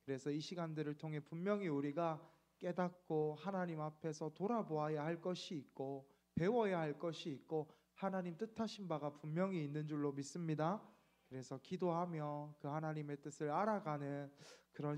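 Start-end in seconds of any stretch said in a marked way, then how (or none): none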